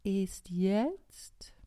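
slow, loud and lazy snail; sluggish snail. Acted. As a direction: drag it out, ignoring the hurry. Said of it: "mit ihrer gewohnten Trägheit".

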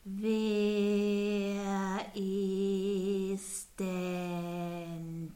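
slow, drawn-out snail voice; a sleepy snail calling down very slowly. Acted.

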